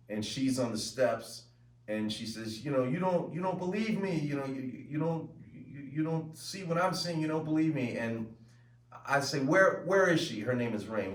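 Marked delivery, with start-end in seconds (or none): 2.6-8.0 higher-pitched, pleading acted protest; upset daughter arguing. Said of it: "we're having an argument". 9.4-10.4 higher, mildly demanding acted question; arguing father. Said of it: "we're having an argument".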